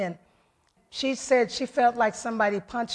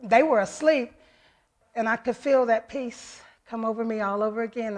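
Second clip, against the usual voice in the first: ordinary talk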